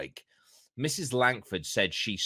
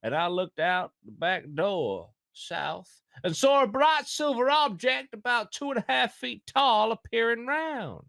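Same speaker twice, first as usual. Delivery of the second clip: American accent; 10 semitones higher than usual. Shown as a demonstration. Reading the witness statement aloud in a put-on Kentucky accent. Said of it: "read it in an American accent".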